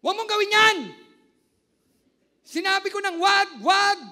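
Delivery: yelling in anger, very loud and high; angry shout. Shown as a demonstration. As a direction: scream it like a furious parent. Pastor acting angry shout. (galit na sigaw)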